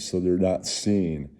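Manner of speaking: usual speaking voice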